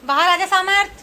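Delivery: high, loud call to come out; loud come-out-now call. Real, not acted. Calling Samarth out.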